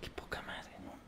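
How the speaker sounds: whispered aside in disgust; muttered curse. Real, not acted. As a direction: swear under your breath, barely audible. Swears under breath at the blunder. (maldición susurrada entre dientes)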